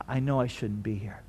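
low, hushed admission; subdued, humbled murmur; quiet confession. Acted. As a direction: admit it softly, brokenly. Confession in a place of brokenness.

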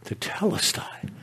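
soft whispered Tetelestai; whispered echo. Retold or imitated. The cry echoed back as a whisper. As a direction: let "Tetelestai" fall to a breath.

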